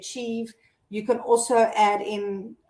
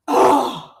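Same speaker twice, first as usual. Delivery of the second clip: imitated groan; 3 semitones higher, 8 dB louder than usual. Son caught again at breath check.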